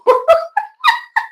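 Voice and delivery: a real burst of high-pitched laughter. Real, not acted. Host cracks up at a viewer comment, high squealing laugh.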